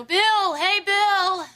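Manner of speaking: retold yell. Woman retelling how she yelled up at Bill's window, high retold yell. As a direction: call up to a window, voice pitched high. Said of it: "I just started yelling".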